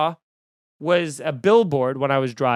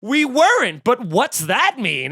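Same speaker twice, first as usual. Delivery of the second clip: mock ad actor; 7 semitones higher, 5 dB louder than usual. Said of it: "And they're like".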